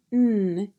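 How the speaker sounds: N as a drawn-out "nnn"; held nasal "nnn" for N; nasal N sound. Shown as a demonstration. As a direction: say N as a hum through the nose, not "en". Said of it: "is a nasal sound".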